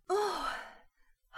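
high breathy gasp of awe; high, awed little sigh; awed breath. A narrator's reading. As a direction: breathe out the word in wonder. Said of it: "hauchte sie ehrfürchtig".

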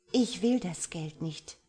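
ordinary delivery

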